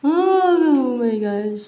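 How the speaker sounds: a high, loud "Oh" then a slow "oh my gosh"; real amazement at the card drawn; surprised gasp. Real, not acted. Reacting to a tarot card. (gulat na "oh my gosh")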